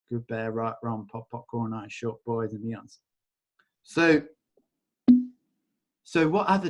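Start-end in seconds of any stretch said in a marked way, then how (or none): 0.1-3.0 rattled-off ritual formula, much softer; tossed-off chant, dropping away; quick mumbled incantation. Acted. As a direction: rote clearing phrase, murmured. Recites clearing statement.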